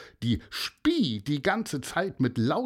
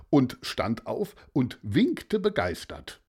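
ordinary delivery, nothing marked

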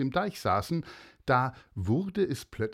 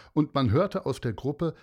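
usual speaking voice

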